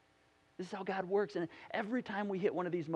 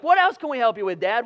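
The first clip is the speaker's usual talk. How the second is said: loud, higher eager offer; teasing siblings; 3 semitones higher, 12 dB louder than usual. Retold or imitated. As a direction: rub it in with mock eagerness. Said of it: "they're like".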